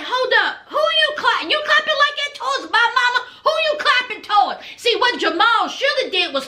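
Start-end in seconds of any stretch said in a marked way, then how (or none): 0.0-4.6 high-pitched, affronted mock challenge; acted-out protective son squaring up; indignant confrontation. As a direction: jump up in pitch, demanding answers for mama. Son confronting man clapping at mama.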